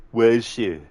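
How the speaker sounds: action-hero movie impression, forceful; Arnie. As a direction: play the menacing action hero interrogating his captive. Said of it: "an Arnie movie scene impression".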